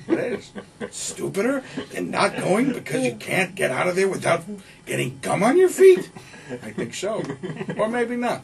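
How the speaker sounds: put-on old movie critic. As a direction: argue with yourself like a grumpy geezer. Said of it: "characterizations with Pacino and De Niro".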